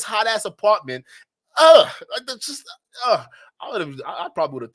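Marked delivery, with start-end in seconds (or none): 1.6-3.3 loud, high-pitched "ugh" of revulsion; disgusted groaning. Real, not acted. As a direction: recoil in disgust, groaning between broken words. Disgust at imagining cramped hot apartment.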